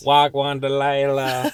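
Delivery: slow Toronto slang drawl. Retold or imitated. Hosts mocking the Toronto podcast intro in Toronto slang. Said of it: "the Toronto slang version".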